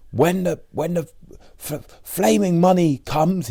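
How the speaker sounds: very high, stammering voice, drawn out; imitating Richard's stammer. Hungry Richard promising a feast when money comes, high stammer. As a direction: stutter the start, then blurt it.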